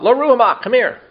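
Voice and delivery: high, loud call across the house, imitating a parent; parent calling a child. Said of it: "imagine growing up as a child".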